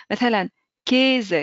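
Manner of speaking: كيزة said slowly as Käse, keh-zeh with an e sound; كيزة drawn out as keh-zeh, the German word for cheese; German pronunciation. Shown as a demonstration. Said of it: "كيزة اللي هو الفرomاج".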